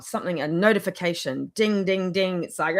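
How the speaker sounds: ordinary delivery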